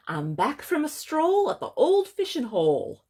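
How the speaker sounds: high, loud smiling badger; cheerful badger. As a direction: sing out the news as a pleased storybook animal. Storyteller voices smiling Badger.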